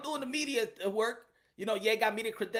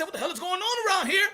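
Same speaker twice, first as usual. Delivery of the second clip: retold exasperation; 8 semitones higher, 4 dB louder, faster than usual. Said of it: "I said".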